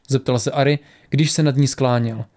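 plain reading voice

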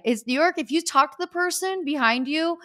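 ordinary talk, nothing marked

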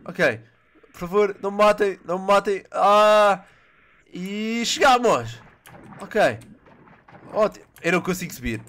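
ordinary talk

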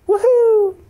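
high, loud whoop of joy; excited cheer. Real, not acted. Gamer cheering that no download is needed, a loud high whoop. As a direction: cheer it out, thrilled.

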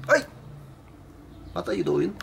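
ordinary talk, nothing marked

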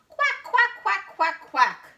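loud, high duck quacks; Dottie. A narrator's reading. Noisy duck in the park, shouted quacking. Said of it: "shouts Dottie".

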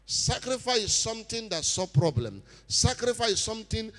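preacher stretching out the key words; forceful preaching. Real, not acted. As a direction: drive each point home to the congregation.

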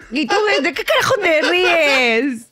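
high, drawn-out mock indignation; indignant scolding. Storyteller scolds co-host laughing at dad's illness, indignant.

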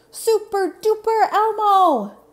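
high, loud, drawn-out superhero yell; Elmo yelling. Acted. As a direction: yell it like a flying superhero, slowly.

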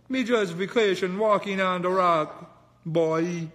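loud faked Jamaican accent; fake Jamaican. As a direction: bluff the cop in a mock Jamaican accent. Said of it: "saying all type of Jamaican things".

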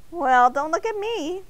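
high, indignant little voice; squeaky cartoon protest; high donut voice. A narrator's reading. Arnie voiced in story.

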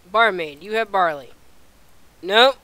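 ordinary talk, nothing marked

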